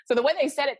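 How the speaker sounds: ordinary talk, nothing marked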